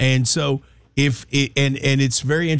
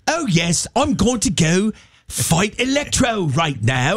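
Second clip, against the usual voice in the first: hammed-up British accent, higher pitch; British Spider-Man; 4 semitones higher than usual. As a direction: play a superhero as an over-proper Brit. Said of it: "if Spider-Man talked like that".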